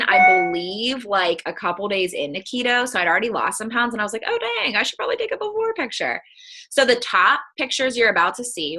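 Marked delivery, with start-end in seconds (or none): 4.2-6.2 high, quick "oh dang" as it dawns on her; mock her own past self catching the mistake; sudden realization. Retelling to the group how she remembered the photo, high and quick.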